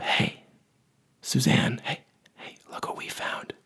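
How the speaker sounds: soft unvoiced whisper. Parents gently waking their sleeping daughter, whispering. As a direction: coax her awake without a sound. Wake her up.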